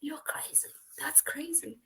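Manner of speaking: soft unvoiced whisper; whispered aside of disbelief. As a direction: say it under your breath. Her muttered reaction to the man.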